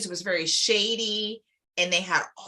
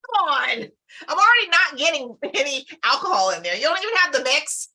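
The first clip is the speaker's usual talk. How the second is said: exasperated; 6 semitones higher, 4 dB louder than usual. Retold or imitated. Retold complaint about missing mix.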